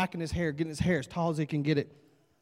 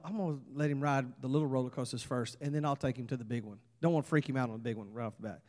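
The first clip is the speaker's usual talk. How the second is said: lower, much softer inner monologue; low, quick private reasoning; thinking to himself; 6 semitones lower, 11 dB quieter, faster than usual. Acted. Dad privately planning to ease his son in, thinking aloud. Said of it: "So he thought".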